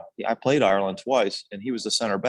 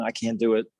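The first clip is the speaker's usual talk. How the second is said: ordinary delivery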